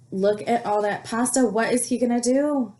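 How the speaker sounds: ordinary delivery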